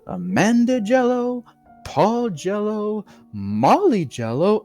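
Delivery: sing-song, proudly naming each; host voice. A narrator's reading. Host names the tubs.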